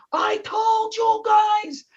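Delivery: high, slow, drawn-out angry yell; the fed-up captain exploding at his crew; captain blowing his stack. Retold or imitated. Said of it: "the captain initially blows his stack".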